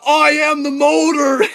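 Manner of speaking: a cyclist shouting to hikers, slow, high and loud; hollered. Retold or imitated. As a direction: yell it proudly while riding past. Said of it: "she just hollers at us".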